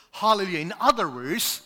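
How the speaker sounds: ordinary talk, nothing marked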